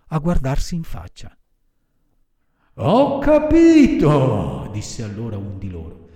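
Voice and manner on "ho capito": high, loud, drawn-out villain voice; scheming villain. A narrator's reading. One assassin realises what to do, high villain voice. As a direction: draw it out as the plan dawns.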